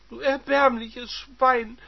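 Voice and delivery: high, loud, slow scream; screaming wife. Acted. Wife screaming insults at her husband. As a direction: spit the insult at him. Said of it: "schrie sie ihn an".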